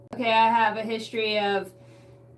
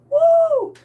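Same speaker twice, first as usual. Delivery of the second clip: high, loud whoop; excited whoop about grandparents; 8 dB louder than usual. Real, not acted. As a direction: let out a big woo.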